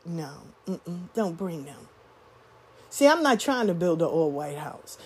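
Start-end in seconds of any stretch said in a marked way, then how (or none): 0.0-1.9 much softer, low dismissive mutter; low hushed mock refusal; mocking hushed gatekeeper. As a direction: murmur it like a snobby insider refusing.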